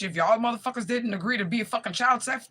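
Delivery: ordinary talk, nothing marked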